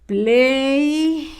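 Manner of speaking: drawn-out PLAY, held long; dictating by syllable. Shown as a demonstration. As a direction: stretch Play slowly for pupils writing along. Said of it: "La sílaba ple va con color rojo".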